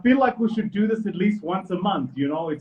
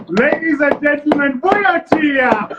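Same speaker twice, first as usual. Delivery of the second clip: high, loud show host announcement; big booming introduction to the crowd; announcer introduction; 7 semitones higher, 8 dB louder, slower than usual. Acted. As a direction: hype the guest like a ring announcer. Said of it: "host announcing guest".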